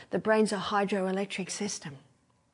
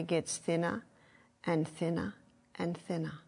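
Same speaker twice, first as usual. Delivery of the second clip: fading away; 3 semitones lower, 8 dB quieter than usual. Shown as a demonstration. Fading as the pathway thins.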